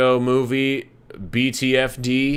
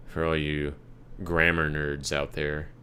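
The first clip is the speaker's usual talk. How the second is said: ordinary delivery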